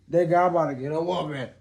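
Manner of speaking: loud, high panicked cry of dread; panicked yell. Retold or imitated. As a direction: yell it like the whooping is coming. I'm like.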